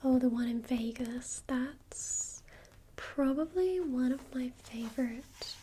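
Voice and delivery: normal talking voice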